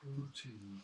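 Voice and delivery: put-on soft "Poutine" in a light French-Canadian accent; quiet mock French accent. Co-host murmuring the dish name in a mock French accent. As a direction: breathe "Poutine" with a hint of French. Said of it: "doing the accent".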